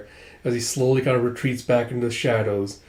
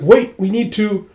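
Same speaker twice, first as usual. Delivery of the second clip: urgent plea; 8 semitones higher, 6 dB louder than usual. Acted. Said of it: "She's like".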